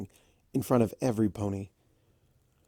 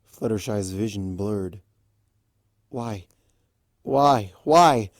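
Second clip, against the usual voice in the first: usual speaking voice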